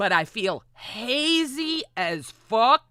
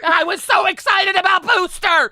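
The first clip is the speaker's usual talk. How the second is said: much higher and louder, gushing; excited; 10 semitones higher, 9 dB louder than usual. Real, not acted. Streamer gushing about Booster, loud and excited. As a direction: shout your enthusiasm.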